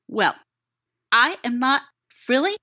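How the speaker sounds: usual speaking voice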